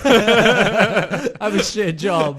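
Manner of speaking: very loud, slow goofy character; hammed-up character drawl. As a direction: boast in a silly voice, cracking up. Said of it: "in our [name] voice".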